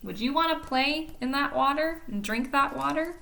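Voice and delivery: ordinary delivery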